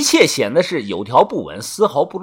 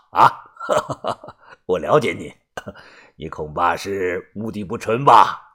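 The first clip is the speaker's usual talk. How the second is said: put-on lower, chuckling sly old man; teasing laugh; 5 semitones lower than usual. A narrator's reading. Grandpa teases Xiansheng about an old lady, chuckling. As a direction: needle him with knowing laughter. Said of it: "李爷笑着问道".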